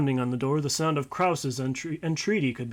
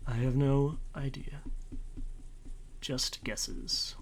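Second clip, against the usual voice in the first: whispering; 7 dB quieter, slower than usual. Real, not acted. Whispered aside.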